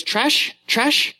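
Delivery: put-on high, sing-song little girl calling out; toddler voice. Dad imitating his toddler daughter, high and eager. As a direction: copy a two-year-old eagerly naming things. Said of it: "she goes".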